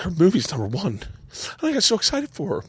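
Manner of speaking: choked up, voice jumping high; real emotion pushing the voice up; fighting back tears as you tell it; near tears. Real, not acted. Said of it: "I might actually cry".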